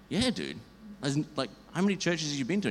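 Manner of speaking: pumped, fast question to a new friend; eager dude talk. Retold or imitated. Retelling his own eager reply.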